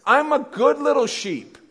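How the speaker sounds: high, loud mock whining; self-righteous protest. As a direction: plead your innocence, hammed-up and indignant. Playing the wronged believer to God.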